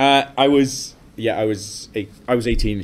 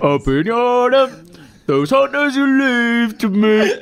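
high, slow put-on voice; hammed-up high sing-song; high mock voice; 11 semitones higher, 3 dB louder, slower than usual.

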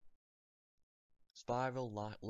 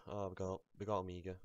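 ordinary talk

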